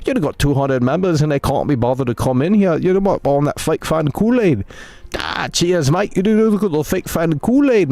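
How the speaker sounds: hammed-up mix of Australian and British accents; mock British-Australian accent. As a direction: put on a muddled Aussie-Brit accent, tossing in "mate". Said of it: "sounds a lot more Australian than British".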